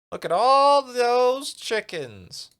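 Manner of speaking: high, slow, loud squeal of glee; gleeful high squeal at the chickens; squealing delight. Pretend. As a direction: stretch it out like a delighted kid. Gleeful shout about chickens.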